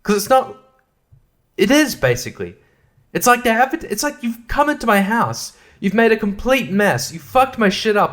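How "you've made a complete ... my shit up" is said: normal talking voice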